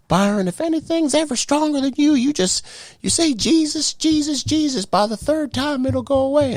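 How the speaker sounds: high loving grandma voice; grandma's voice. Retold or imitated. Grandma's voice in vision.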